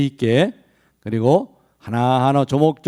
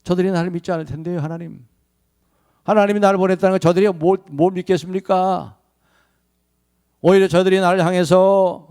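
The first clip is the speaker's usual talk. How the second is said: anxious objection; 6 semitones higher than usual. Acted. Moses voiced objecting to God.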